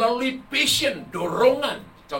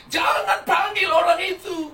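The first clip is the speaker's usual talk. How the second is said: high, loud, drawn-out shriek; demon's scream; 10 semitones higher, 7 dB louder, slower than usual. Retold or imitated. Evil spirit in possessed girl warns off the pastor, screaming. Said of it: "roh jahat dalam dia teriak".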